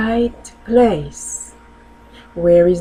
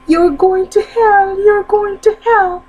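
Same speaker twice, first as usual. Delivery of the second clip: high parrot squawk, a bit louder; 12 semitones higher, 3 dB louder than usual. Acted. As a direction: screech it like a parrot. Parrot squawks at priest again.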